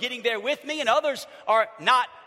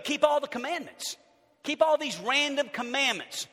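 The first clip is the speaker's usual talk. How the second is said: ordinary delivery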